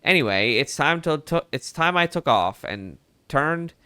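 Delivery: put-on old-timey Mid-Atlantic reporter voice; Mid-Atlantic accent. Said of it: "started going a little southern there".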